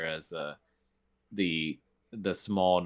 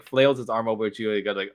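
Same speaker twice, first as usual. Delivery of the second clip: normal talking voice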